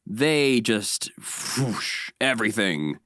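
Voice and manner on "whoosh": soft, airy whooshing noise; imitated whoosh. Host mimicking a flood of YouTube content, imitated whoosh. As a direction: make a quick rushing-air sound.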